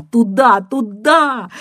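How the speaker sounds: loud, high, choked cry; anguished cry. Acted. Caller cries out to Georgy, anguished and near tears. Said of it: "крикнула она надрывно, толчками и, кажется, плача".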